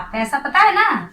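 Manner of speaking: usual speaking voice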